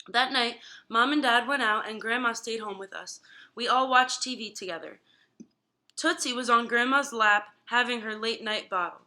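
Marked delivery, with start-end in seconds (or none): none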